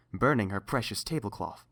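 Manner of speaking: plain reading voice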